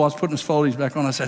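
usual speaking voice

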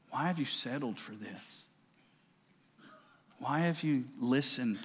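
a hushed, tender God asking Elijah why he is there; still small voice. Acted. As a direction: speak it like a still small voice calling in a cave. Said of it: "here's the still small voice".

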